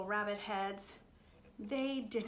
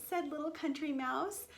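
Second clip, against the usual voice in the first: ordinary delivery, nothing marked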